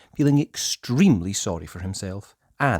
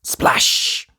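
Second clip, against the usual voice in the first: sharp breathy splash; splash sound word; 5 dB louder than usual. A narrator's reading. Paintbrush hitting Nosey's nose, a sharp splash. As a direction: spit out the splash.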